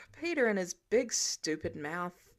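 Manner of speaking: ordinary delivery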